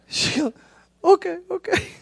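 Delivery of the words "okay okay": imitating her high, tearful okay; hammed-up little high voice; fiancée giving in. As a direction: squeak out a meek mock agreement. Acts fiancée giving in after crying.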